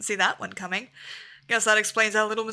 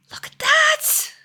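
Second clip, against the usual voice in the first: awed whisper; 7 dB louder, much slower than usual. Real, not acted. Awed at the new zone.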